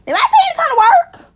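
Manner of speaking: very high, loud mock outburst; indignant shout. Imagined patient telling off a grumpy nurse, indignant shouting. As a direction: yell it in high-pitched outrage.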